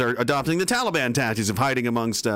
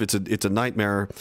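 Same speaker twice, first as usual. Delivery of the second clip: ordinary talk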